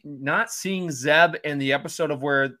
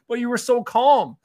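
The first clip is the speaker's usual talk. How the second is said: mock protest; 11 semitones higher, 6 dB louder than usual. Sabine's retort voiced.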